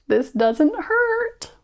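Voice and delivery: slow, very high squeak. A narrator's reading. Prince bracing for the dreaded kiss, slow high squeak. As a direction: squeak it out nervously, drawing it long. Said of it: "he squeaked".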